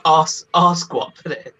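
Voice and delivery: "ass" as a drawn-out British "arse"; slow British "arse guac", stretched vowels; British accent. Shown as a demonstration. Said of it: "when you say ass and I say ass".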